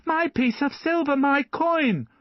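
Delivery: put-on high, loud child's cry; a boy crying out for his stolen coin. A narrator's reading. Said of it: "the boy cried".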